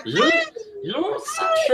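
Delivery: very high silly alien voice; squeaky goofy creature; Jar Jar voice. Acted. Acting Jar Jar.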